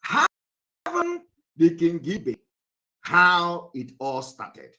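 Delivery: slow, drawn-out question. Real, not acted. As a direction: stretch each word out for the class. Lecturer repeating question.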